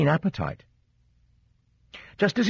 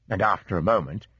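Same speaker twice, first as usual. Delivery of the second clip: ordinary delivery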